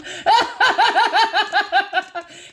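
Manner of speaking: loud high cackle; cackling at her own joke; high cackling laugh. Real, not acted. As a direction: burst out cackling. Laughs after the worm joke.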